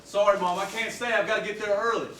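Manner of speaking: loud and hurried. Acted. Son rushing out to dance.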